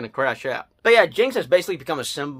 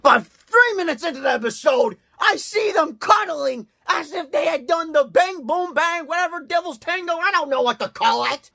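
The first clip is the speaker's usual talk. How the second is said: outraged ranting; 12 semitones higher than usual. Real, not acted. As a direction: rant in disbelief at what you saw. Ranting at the shocking scene.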